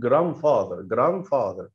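grandfather said slowly, the d softened to a th; no d sound. Shown as a demonstration. Teacher correcting a pupil, grandfather without the d. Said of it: "مش بنقول الدي خالص".

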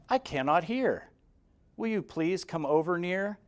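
ordinary delivery

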